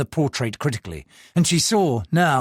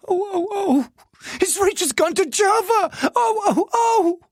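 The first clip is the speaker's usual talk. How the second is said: high wailing cries. Acted. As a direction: wail in distress. Servant wailing.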